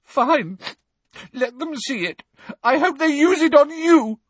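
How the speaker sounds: high, loud tearful outburst; sobbing, vengeful. A narrator's reading. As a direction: spit it through sobs. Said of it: "now sobbing into her hands again".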